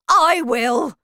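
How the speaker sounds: loud, high volunteering cry; eager shout. A narrator's reading. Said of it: "shouted Grandpa Joe".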